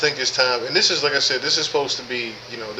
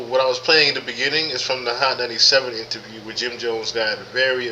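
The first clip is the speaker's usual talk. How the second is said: ordinary delivery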